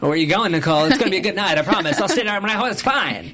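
high, sing-song fake friendly; mock creepy Zoe. Imitating Zoe sweetly assuring Nicole, mock creepy. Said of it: "It was like".

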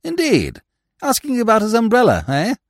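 high, quick echoing question; lofty gentleman, lightly intrigued; blithe interest. Acted. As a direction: repeat it back with airy, unbothered interest. Smith blithely echoes the attendant.

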